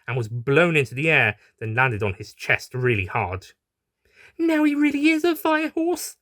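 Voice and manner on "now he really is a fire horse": high, lightly voiced doctor; a hint of a sly high aside to herself; quiet gloating aside. A narrator's reading. Doctor muttering about the horse, lightly voiced high aside. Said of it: "the doctor said quietly to herself".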